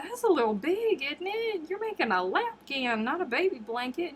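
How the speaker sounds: high, mock-skeptical teasing; teasing disbelief. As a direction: needle her cousin about the size. Retells teasing cousin Amy.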